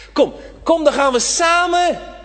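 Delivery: high, loud coaxing call; preacher urging frightened children to come, high and loud; pleading invitation. Acted. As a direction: beckon the children to come along.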